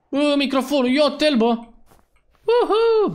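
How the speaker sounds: high, rising whoop of triumph; excited cheer. Real, not acted. Streamer cheering over the microphone, excited. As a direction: call out high and end in a whoop. Microphone finally arrives in game.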